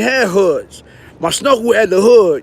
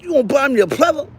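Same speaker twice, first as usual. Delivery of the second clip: accusing; 5 semitones higher, faster than usual. Retold or imitated. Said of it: "I said".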